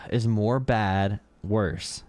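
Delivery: sighed, slow and drawn out; slow mock question. Streamer mocking the debater, slow sighing question. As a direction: spell it out like to a child. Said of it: "the Patrick Wallet meme".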